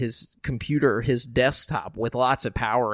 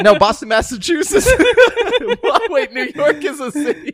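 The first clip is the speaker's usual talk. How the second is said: laughing; 9 dB louder than usual. Real, not acted. Laughing at their own mix-up.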